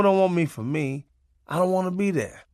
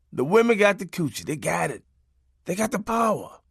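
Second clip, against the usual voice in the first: ordinary talk, nothing marked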